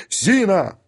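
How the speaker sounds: high, falling call. A narrator's reading. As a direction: summon someone from another room. Calling out for the maid.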